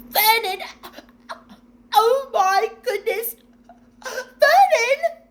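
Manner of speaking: very high, loud, near tears; panicked cry. A narrator's reading. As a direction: call for her husband in horror. Petunia about to faint.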